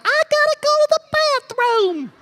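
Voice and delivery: put-on very high squeaky little-girl voice; girls yelling. Girls in the wagon pleading with parents, very high squeal. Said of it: "all the girls were yelling".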